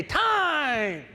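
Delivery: high, drawn-out coach's call; calling out time. Retold or imitated. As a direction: call it across the gym.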